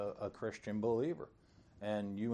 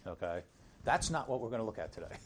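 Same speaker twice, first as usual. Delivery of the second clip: ordinary talk, nothing marked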